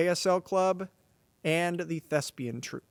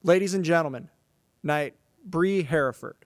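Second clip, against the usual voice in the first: knighting ceremony; 4 dB louder than usual. Real, not acted. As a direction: present the honoree to the crowd.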